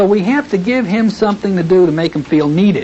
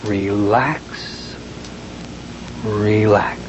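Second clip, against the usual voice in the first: much lower, soft and drawn-out relax; gentle relax; 8 semitones lower, 5 dB quieter, much slower than usual. Shown as a demonstration. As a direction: press them down into calm with the voice. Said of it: "put my hand up there and go".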